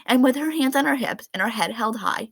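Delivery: usual speaking voice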